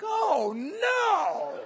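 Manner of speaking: high, swooping cry of dismay; comic dread. Retold or imitated. Seatmates seeing a big man arrive, comic dread. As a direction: wail it in mock horror.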